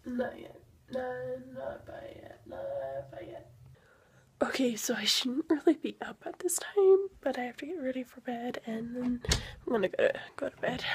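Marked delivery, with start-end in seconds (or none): 0.9-2.4 slow whispered correction; whispering. Real, not acted. 2.5-3.5 much lower, slow whisper. Real, not acted. 4.4-7.1 urgent late-night whisper. Real, not acted.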